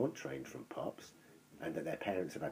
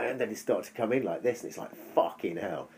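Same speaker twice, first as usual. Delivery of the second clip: normal talking voice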